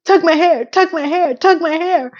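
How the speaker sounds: mock high yells of tug my hair; mock screaming. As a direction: yelp it like a lover in the moment. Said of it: "And he's screaming".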